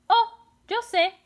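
put-on high gasped eureka for children; gasp, then announce the idea brightly; playful burst of discovery; sudden idea.

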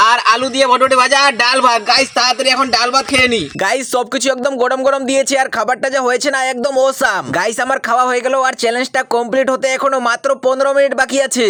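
usual speaking voice

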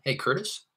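"Curtis" swung sharply upward as a question; questioning inflection. Shown as a demonstration. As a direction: ask "Curtis" to confirm the name, pitch climbing. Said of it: "to kinda ask a question".

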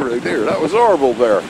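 usual speaking voice

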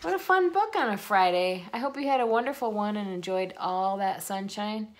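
plain reading voice

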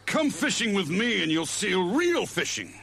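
high puffed-up caveman voice; cartoon Fred, pompous. A narrator's reading. Fred boasts about his rod to Barney, pompous cartoon voice. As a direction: show off grandly. Fred's voice.

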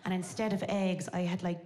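ordinary talk, nothing marked

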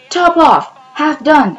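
high, startled exclaiming; surprised mouse. Acted. Mouse repeating the cat's strange names, startled. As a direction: blurt both names in wonder.

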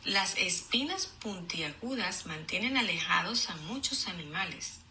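clear, even read-aloud; storybook reading voice; reading to children. Acted. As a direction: read the page to the class. Teacher reads book aloud.

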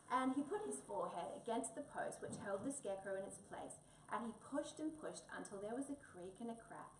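usual speaking voice

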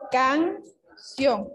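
"Canción" said as two separate beats, can, ción; split into syllables. Shown as a demonstration. Teacher showing pupils how to separate a word, "Canción" syllable by syllable. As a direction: break "Canción" after "Can", with a clear pause before "ción". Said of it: "separar la palabra en sílabas".

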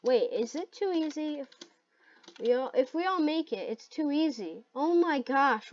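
normal talking voice

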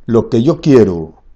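loud, sweeping recital opening; grand poetry declamation. Acted. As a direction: declaim it like a stage poet. Recites the poem aloud.

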